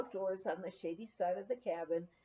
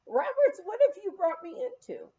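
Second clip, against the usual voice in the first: much higher and louder, astonished; a flustered scholar's outburst, pitched up; puzzled dismay; 10 semitones higher, 6 dB louder than usual. Retold or imitated. Dignified guest startled by the messy cabin, high astonished. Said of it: "wondering to himself".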